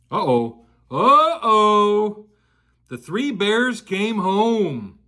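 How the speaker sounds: ordinary delivery, nothing marked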